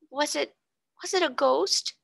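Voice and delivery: high, trembling frightened question; quaking. A narrator's reading. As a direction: quaver it out in fear. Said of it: "quaked Ermengarde".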